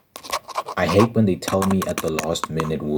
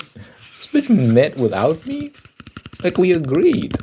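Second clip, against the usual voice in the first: high, slow, indignant rant; outraged disbelief; 9 semitones higher, slower than usual. Retold or imitated. Friends met without her, a high indignant outburst. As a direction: let the outrage push the voice up.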